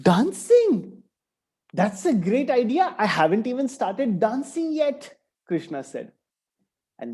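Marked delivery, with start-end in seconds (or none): 0.1-5.2 put-on playful boy. Krishna teases the snake.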